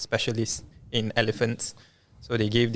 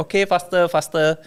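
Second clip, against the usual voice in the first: put-on high, loud Thai-accented "faster, faster"; 7 semitones higher, 4 dB louder than usual. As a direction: imitate the Thai doctor's accent, urging. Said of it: "in the Thai accent".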